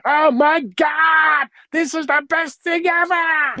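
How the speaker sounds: high, drawn-out mock gushing; over-the-top American enthusiasm. Dutch guest parodying what his countrymen never say, wildly gushing. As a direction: gush at full pitch, stretching the words. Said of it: "They will never say".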